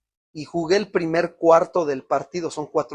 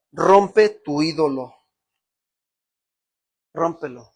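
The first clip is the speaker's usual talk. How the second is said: slow solemn command; 4 dB louder, slower than usual. Retold or imitated. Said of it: "porque me dijo".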